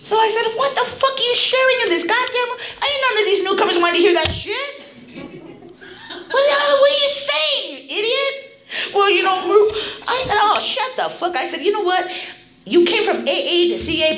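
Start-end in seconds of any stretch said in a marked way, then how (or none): 0.6-8.3 very high-pitched, hammed-up indignation; acted outraged scolding. Member berating a woman for what she shares before newcomers, outraged. 8.9-9.7 high mock-tearful stammer; whiny protest. Scolded woman trying to defend her share, mock whining. 10.4-14.2 high, exasperated put-down; acted angry retort. Member shutting down the woman's excuse at the meeting, exasperated. Acted cutting her off angrily.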